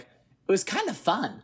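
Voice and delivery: put-on high, slow little kid reply; kid's voice. Storyteller voicing the kid who watched the fight, high slow kid voice.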